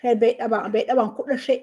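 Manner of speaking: ordinary delivery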